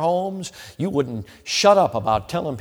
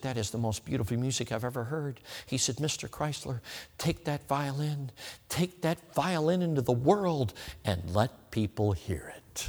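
moved to tears; 9 dB quieter than usual. Retold or imitated. Collector, moved by the music, speaking to Chrysler through mild tears. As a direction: let it come out hushed and choked. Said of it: "the collector began to cry".

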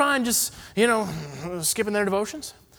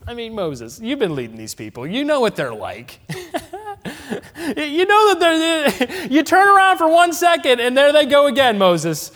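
laughing Aaron; 8 semitones higher, 5 dB louder than usual. Pretend. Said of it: "Can I put it another way?".

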